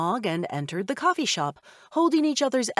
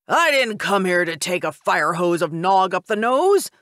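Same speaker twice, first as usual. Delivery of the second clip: a man's voice, louder and outraged; indignant man; 6 dB louder than usual. Acted. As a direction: complain like a soaked bystander. Angry drenched man in crowd.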